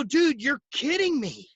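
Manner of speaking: dude, no way kind of astonishment; amazed disbelief. Retold or imitated. Agent to buddy about the leads, amazed disbelief. Retells amazed reaction to lead system.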